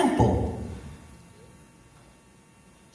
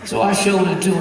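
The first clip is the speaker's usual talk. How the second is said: ordinary talk, nothing marked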